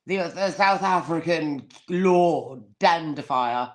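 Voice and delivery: normal talking voice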